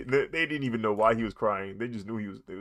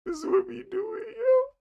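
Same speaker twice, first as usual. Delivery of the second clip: squeaky mock reply; 6 dB louder than usual. Voicing the villain's reply.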